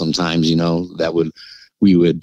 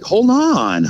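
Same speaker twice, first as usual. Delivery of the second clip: pleading dad. Retold or imitated. Dad resisting being pushed out.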